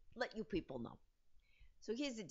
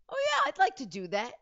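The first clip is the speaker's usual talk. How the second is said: loud, high, re-enacted eagerness; eager; 8 semitones higher, 10 dB louder than usual. Newcomer to casting director, acted loud eagerness. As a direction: jump at the chance, pitch and volume up. She jumps at the casting offer.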